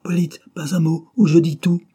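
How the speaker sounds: high, loud warning cry; stentorian shout. A narrator's reading. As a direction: shout a threat from outside. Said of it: "une voix de stentor cria".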